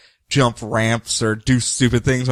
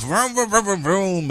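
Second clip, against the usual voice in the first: higher engine revving noises; motorcycle vroom; 5 semitones higher than usual. Retold or imitated. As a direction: mimic a speeding motorbike. Motorcycle chase sound effect.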